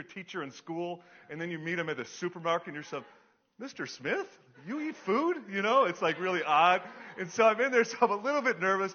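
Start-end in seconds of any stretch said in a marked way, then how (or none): 3.6-5.4 high, rising incredulous question; amazed pupil. Acted. Pupil meets teacher at the supermarket, acted astonishment. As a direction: ask in mock disbelief.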